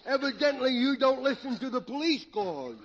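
tipsy, stumbling over the long words; comic drunk. Acted. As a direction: play a proud drunk fumbling his words.

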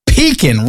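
Pecan echoed as PEE-kin, stress up front. Shown as a demonstration. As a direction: repeat Pecan with a strong PEE and weak "kin". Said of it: "heard some people say pecan".

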